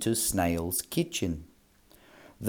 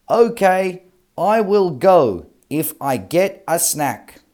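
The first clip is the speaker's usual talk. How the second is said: monster agreeing; 8 semitones higher, 6 dB louder than usual. Acted.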